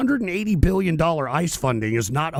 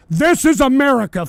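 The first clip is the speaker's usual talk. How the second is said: loud declaration; 7 semitones higher, 8 dB louder than usual. Real, not acted. Rant catchphrase.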